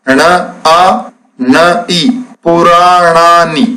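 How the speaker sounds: ण आ न ई spelled out slowly sound by sound, then पुराणानि joined; sounding out letters slowly. Shown as a demonstration. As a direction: build the word पुराणानि letter by letter, very slow. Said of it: "देखिए कैसे शब्द बनाते हैं".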